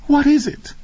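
very high, breathy female voice; acted scared woman's voice; frightened question. A narrator's reading. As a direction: ask in hushed dread. Whispered exchange continues, frightened woman.

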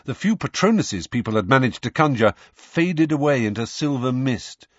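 ordinary delivery, nothing marked

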